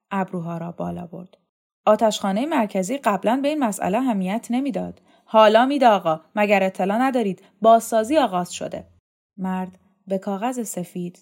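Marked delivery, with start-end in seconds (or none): 1.9-8.9 much louder, insistent official correcting his visitor. Acted. Dormitory chief corrects the inspector about renovations, insistent. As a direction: press the point loudly and formally. Voices chief answering back sharply.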